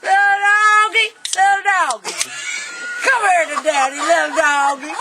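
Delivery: put-on calls out high and loud, slowed, like a drunk man calling his dog; retold hollering. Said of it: "he's in there sitting hollering".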